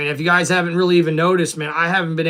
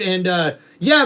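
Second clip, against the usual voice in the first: usual speaking voice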